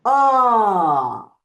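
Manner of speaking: loud long "ah", high then sweeping down; full-circle tone. Shown as a demonstration. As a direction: hold "ah" high and let it fall all the way. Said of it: "full circle".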